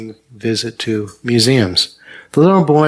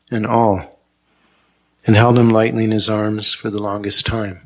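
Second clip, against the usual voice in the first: ordinary talk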